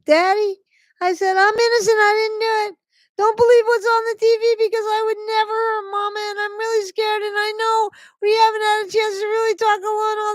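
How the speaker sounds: mock helpless squeal, very high and rushed; frantic little-girl voice. Daughter pleading with dad on the phone, mock babyish squeal. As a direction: gabble it in a hammed-up babyish high pitch.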